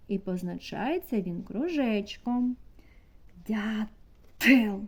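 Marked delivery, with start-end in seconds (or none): 3.5-4.8 "Дятел" split before a forceful Т; pause, then punch the Т in "Дятел"; виділений звук т. Shown as a demonstration. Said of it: "Який наступний звук?". (відокремлене, різке Т у слові "Дятел")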